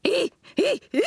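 repeated high ape screeches; high monkey squeals in a row; chimp screeches. A narrator's reading. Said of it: "he screeched".

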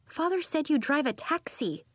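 put-on high-pitched child blurting; sudden, high young-girl remark; blurted out. A narrator's reading. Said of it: "she blurted".

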